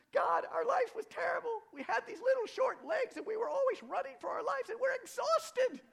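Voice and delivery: very high, loud, frantic little mouse; high mouse shout, breathless from running; squeaky mouse voice. Acted. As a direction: squeal it out like a tiny exhausted mouse. Mouse complains to God.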